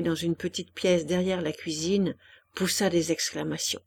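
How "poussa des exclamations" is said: plain reading voice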